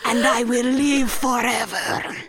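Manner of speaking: high, slow, hammed-up sobbing declaration; melodramatic Dracula. Mocking Dracula's speech to his brides, hammed-up sob. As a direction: overact the tortured vampire, drawing it out. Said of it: "And he's like".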